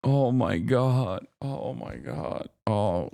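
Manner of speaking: slow, drawn-out groan of disbelief; a drawn-out fed-up oh my God; exasperated groan. Real, not acted. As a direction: let it sag out of you, fed up. Exasperated at chat.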